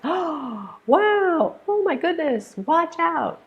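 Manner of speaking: gasp, then high-pitched amazement; surprised exclamations. Shown as a demonstration. Teacher acting out words of surprise, high gasping exclamations. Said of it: "those words of surprise".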